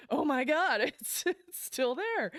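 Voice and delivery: high, loud, laughing it out; laughing disbelief. Real, not acted. Laughing as he finds his arm still there. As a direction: crack up in relief.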